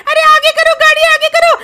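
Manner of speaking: very high, loud squeal; panicked urging. Acted. Companion urges the driver to move the car, panicked high yell. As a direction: yell urgent orders in a squeaky voice.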